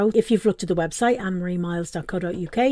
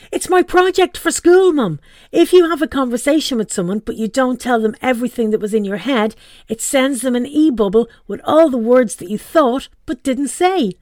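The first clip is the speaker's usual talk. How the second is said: high, louder, eager explaining; excited son; 5 semitones higher, 5 dB louder than usual. Acted. Son eagerly explaining his project to mum. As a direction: gush about his school project.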